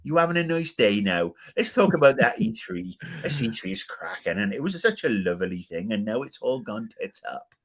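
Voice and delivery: hammed-up British chat, starting high; put-on British accent. Shown as a demonstration. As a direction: play up a cheery British greeting. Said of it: "I can do British accents".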